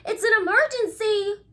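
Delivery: high, loud urgent call for help; urgent cry. Acted. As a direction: cry it out in alarm. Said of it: "cried Ozzy".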